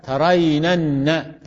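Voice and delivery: very slow "ترينن", holding the doubled final n; repeated nuns shown. Shown as a demonstration. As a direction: draw "ترينن" out slowly so each n is heard. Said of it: "توالي أمثال". (إبراز النونات في «ترينن» ببطء شديد)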